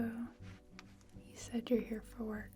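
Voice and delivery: usual speaking voice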